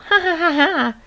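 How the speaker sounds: high mocking ha-ha laugh; hyena's laugh. A narrator's reading. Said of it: "the hyena laughed again".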